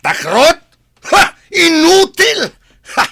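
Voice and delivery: screaming scornful defiance, high and loud; cornered villain shrieking contempt; defiant shout. Acted. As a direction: yell it in his face. Villain confronted by police, defiant.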